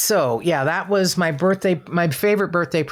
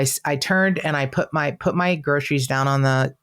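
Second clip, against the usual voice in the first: ordinary delivery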